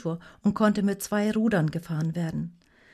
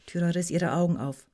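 ordinary delivery

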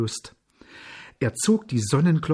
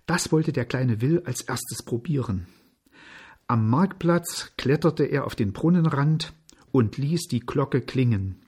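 ordinary delivery

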